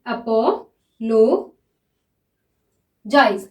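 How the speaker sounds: very slow, spelled-out apologize; stretched-out dictation. Shown as a demonstration. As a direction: apologize drawn out very slowly syllable by syllable. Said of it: "देखिए, अ".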